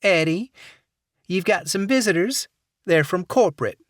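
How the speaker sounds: sweet Southern call through a door; Southern accent. Acted. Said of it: "saccharine Southern accent".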